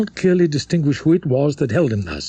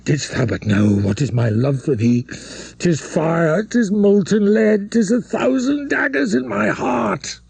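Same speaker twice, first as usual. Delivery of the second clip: passionate rising plea; 7 semitones higher than usual. Acted. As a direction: pour out a tormented love. Priest's passionate confession.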